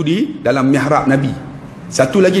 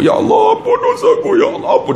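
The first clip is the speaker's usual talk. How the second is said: high, wailing plea that breaks up; mock a man sobbing through his prayer; imitated crying. Pakcik begging forgiveness, imitated tearful wail. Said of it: "Dia teriak".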